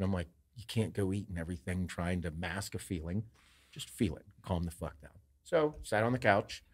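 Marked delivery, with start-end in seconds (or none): 0.6-5.1 quiet self-talk. Retold or imitated. Retelling calming his own anxiety.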